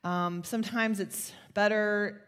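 ordinary delivery

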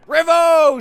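put-on loud, high drunken cry; town drunk. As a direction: holler like a tipsy reveller. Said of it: "says the drunkard".

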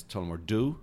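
Tullamore Dew with DEW as a plain "doo"; US pronunciation. Shown as a demonstration. Guest shows how Americans say it, Tullamore Dew ending in "doo". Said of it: "especially in the U S".

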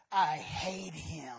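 slow, low grumbling grudge; sullen hater. Retold or imitated. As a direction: grumble it with spite. Critic of preachers voiced.

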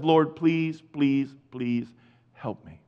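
beg, fading almost to nothing; desperate father's appeal, dying away; pleading. Acted. Father begging, fading with each please.

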